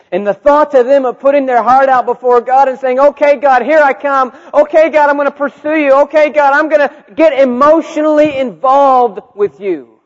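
high, loud, driving delivery; preaching at full force. Acted. Preacher voicing wounded students pursuing God, full force. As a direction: pound out each declaration at full volume.

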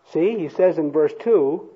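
ordinary talk

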